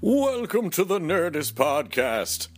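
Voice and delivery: slow, eerie haunted-house host; spooky ghost voice. Pretend. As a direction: drag it out like a ghost. Spooky Halloween host intro.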